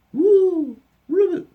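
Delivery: very high, loud owl hoot and frog call; night animal sounds. Pretend. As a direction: hoot like an owl then croak. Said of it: "these are all the sounds".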